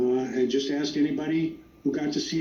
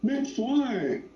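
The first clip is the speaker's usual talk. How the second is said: mock holler, pitched way up without real volume; imitated yell; 9 semitones higher than usual. Retelling a fan spotting Michael J. Fox, imitated yell. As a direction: call it out high like a distant fan. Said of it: "somebody yelled out".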